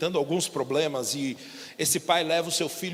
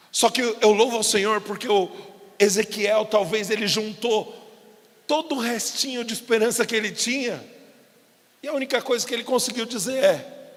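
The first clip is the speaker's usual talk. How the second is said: ordinary talk, nothing marked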